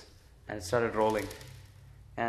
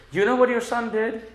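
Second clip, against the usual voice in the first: much higher, louder gossiping neighbour; mock complaining gopi; 11 semitones higher, 6 dB louder than usual. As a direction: tattle to the mother in a high, eager voice. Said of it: "they used to say".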